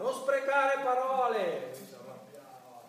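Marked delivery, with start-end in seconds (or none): 0.0-1.7 loud, high, slow yell through the door; mock shout. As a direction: bang on the wall and yell it. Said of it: "picchiavo dentro e gridavo".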